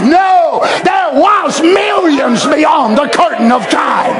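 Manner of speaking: preacher thundering at the church; fiery preaching. Real, not acted. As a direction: hammer each word home to the crowd. Preaching at full force.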